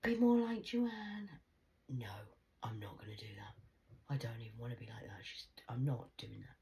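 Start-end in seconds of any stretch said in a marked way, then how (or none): 1.9-6.6 quick defiant whisper; fast, hushed refusal. Real, not acted. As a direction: hiss it under your breath. Defiant aside.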